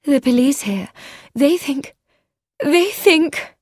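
high-pitched, rising distress; agitated, faltering. A narrator's reading. Woman telling of police suspicions, agitated and faltering. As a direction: break off, pitch climbing each try.

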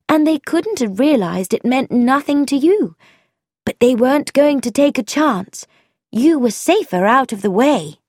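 confident eager explanation; young woman voiced, lively and sure; [name], eager.

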